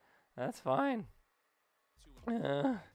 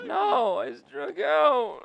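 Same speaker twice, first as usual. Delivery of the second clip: high, loud, slow wail of mock grief; mock crying; 9 semitones higher, 11 dB louder, much slower than usual. Real, not acted. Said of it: "I'm gonna cry".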